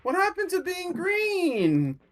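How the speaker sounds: high, slow bewildered question. Acted. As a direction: ask it like a confused child. Rory confused about colour.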